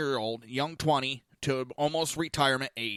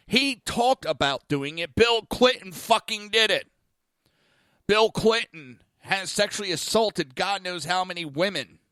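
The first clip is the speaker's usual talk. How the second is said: louder, higher, emphatic accusation; real indignant outburst; 5 semitones higher, 5 dB louder than usual. Real, not acted. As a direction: hammer it out in exasperation.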